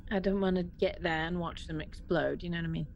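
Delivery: ordinary talk, nothing marked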